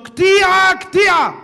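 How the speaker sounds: calling a name loud and high; shout. Retold or imitated. Noblewoman shouts to Ketia, loud and high. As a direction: yell his name across the road. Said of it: "צועקת לו".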